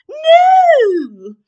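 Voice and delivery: very high, loud refusal; shouted no. Acted. Queen refusing the baby's gift: high shout. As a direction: belt out the no. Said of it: "replied the queen".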